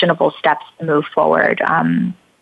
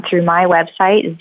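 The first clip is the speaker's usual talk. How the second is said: normal talking voice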